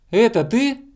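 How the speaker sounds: high-pitched questioning call; hedgehog calling out. A narrator's reading. Hedgehog asking hanging hare, high calling question. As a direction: call up to check who it is. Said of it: "крикнул ёжик".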